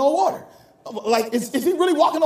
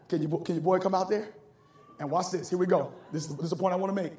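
much softer, lower, intimate sermon voice; hushed preaching; 7 semitones lower, 7 dB quieter than usual. Real, not acted. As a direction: pull the room in close and quiet.

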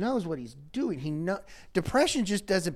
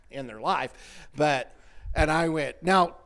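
ordinary talk, nothing marked

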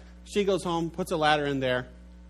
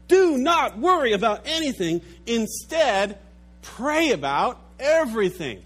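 loud ringing proclamation, higher; preaching; 7 semitones higher, 7 dB louder than usual. Acted. Preacher proclaiming scripture to the congregation, loud preaching. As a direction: proclaim it with full force.

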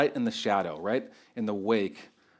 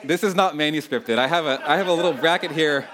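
laughing; 5 semitones higher, 5 dB louder than usual. Real, not acted. Preacher laughs at own tangent.

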